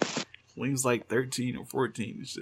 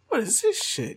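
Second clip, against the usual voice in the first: mock outrage; 10 semitones higher, 4 dB louder than usual. Mock outrage at old tweets.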